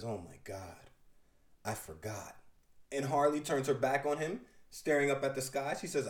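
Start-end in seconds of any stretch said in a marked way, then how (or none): 0.0-2.3 low, much softer horrified murmur; lowered, much softer realization; hushed dread. Acted. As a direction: let it fall away in horror.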